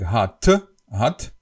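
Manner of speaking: hot with a strong released T; pronounced last T. Shown as a demonstration. Teacher shows Slovak habit, hot with sounded T. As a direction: finish hot on an audible "tuh". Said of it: "we always pronounce the last T".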